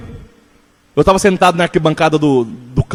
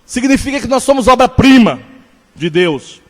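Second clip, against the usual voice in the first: slow, higher-pitched sermon delivery, each phrase weighed; preaching; 8 semitones higher, slower than usual. Acted. Pastor teaching his congregation, in a slow, raised preaching voice. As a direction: preach it slowly, letting every phrase land. Opening sermon exposition on God's workmanship.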